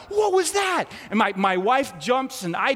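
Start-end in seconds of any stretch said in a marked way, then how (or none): 0.1-0.9 high, loud startled what was that; jolted awake and crying out; sudden shocked outburst; startled yelp. Retold or imitated. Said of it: "And I'm like".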